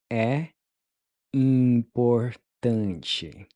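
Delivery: "importante" stretched out very slowly; very slow model. Shown as a demonstration. Teacher modeling "É importante" very slowly. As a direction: draw the word out for learners. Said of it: "How would you say it's important?".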